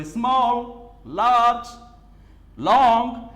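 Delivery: Small, large, long each in one loud push. Shown as a demonstration. Teacher demonstrating single syllables to students, small, large, long each loud and unbroken. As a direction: belt out each of small, large, long without an inner break. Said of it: "voice अगर raise हो रहा है".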